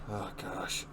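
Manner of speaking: ordinary delivery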